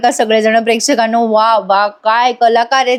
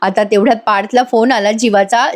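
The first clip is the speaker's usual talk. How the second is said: plain reading voice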